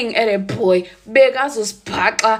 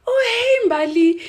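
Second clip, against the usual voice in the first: high, sugary fake hello; fake sweet greeting; 13 semitones higher, 4 dB louder, slower than usual. Greeting Mbali with fake sweetness before the reveal. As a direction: greet her too sweetly.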